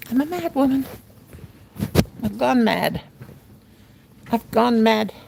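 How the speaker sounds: normal talking voice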